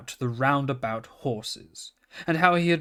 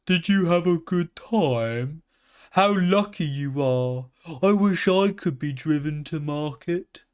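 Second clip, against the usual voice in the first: a lightly voiced little calf, a touch higher; gentle calf; 4 semitones higher than usual. A narrator's reading. Calf wishing she could go to market, lightly voiced.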